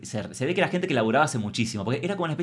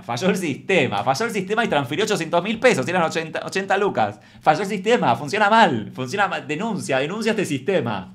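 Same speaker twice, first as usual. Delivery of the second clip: louder, higher mock indignation building up; mock outrage; 5 semitones higher, 5 dB louder than usual. Comedian hamming up blaming the system, louder and higher. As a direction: rant like a wronged customer.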